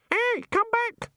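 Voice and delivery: very high, slow parrot squawk; screaming birds. A narrator's reading. Birds in bushes echo the man, screaming squawk. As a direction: screech it like a mimicking bird. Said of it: "screamed the birds in the bushes".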